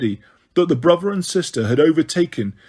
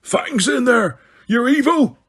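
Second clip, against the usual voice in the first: anguished bellow pitched much higher, a put-on voice; Hagrid's bellow; 8 semitones higher than usual. A narrator's reading. Hagrid crying out that his dog is trapped in the fire, anguished bellow. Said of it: "Hagrid bellowed".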